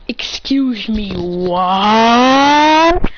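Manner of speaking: slow excuse me, then a loud what; angry disbelief. Retold or imitated. Naruto scolding the kids for going off alone, ending loud. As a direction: build to a loud, outraged what.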